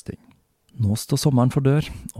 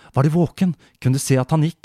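higher and louder, worried mother; anxious questions; 6 semitones higher, 4 dB louder than usual. Acted. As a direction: press the boy for answers. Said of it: "spurte hun". (engstelig mor, lysere og sterkere)

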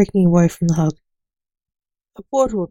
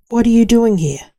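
loud, higher-pitched startled question; startled demand; 4 semitones higher, 7 dB louder than usual. A narrator's reading. Blake to his son who suddenly appears, startled demand. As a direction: demand an answer, raising the voice. Father startled by son's arrival.

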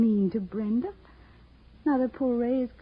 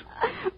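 a short breathy scoffing laugh, high; scornful high laugh; 14 semitones higher, 5 dB quieter than usual. Acted. Brenda scoffs at De Silva's marriage offer with a short laugh. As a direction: laugh him off in contempt.

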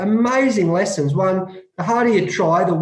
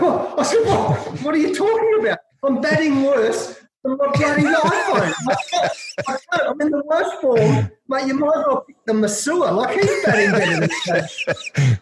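much higher, protesting in mock disbelief; stunned disbelief; 8 semitones higher than usual. Player told he's opening the batting, reacting in high stunned disbelief. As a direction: squeak out a stunned what, barely believing it.

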